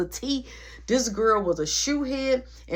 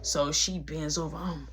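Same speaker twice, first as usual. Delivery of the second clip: plain reading voice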